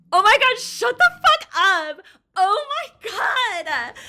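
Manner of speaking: gasp, then loud high outburst; real stunned shriek, stretched at the end; very excited. Real, not acted. Streamer reacting to a reveal in the game, very excited. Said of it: "I'm just very excited".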